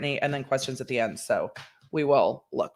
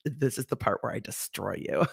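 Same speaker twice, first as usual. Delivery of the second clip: quiet villain threat; 5 semitones lower, 7 dB quieter than usual. Retold or imitated. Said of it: "and I was like".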